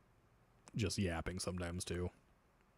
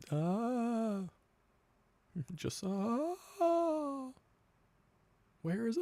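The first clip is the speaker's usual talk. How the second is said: much higher, thin squeaky voice; high falsetto; much slower than usual. Pretend. Silly high falsetto bit.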